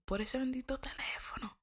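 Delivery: breathy whisper, almost no voice; whispered aside. Real, not acted. She whispers the blame to her listeners, hushed. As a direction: lean in and whisper it like a secret. Scolding aside about the phone.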